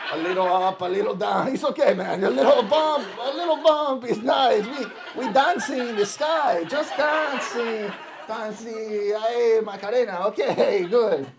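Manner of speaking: high, loud hammed-up Latin American flight announcer; struggling Spanish-accented English. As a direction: cheer passengers through turbulence in thick Spanish-accented English. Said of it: "have to try to speak English".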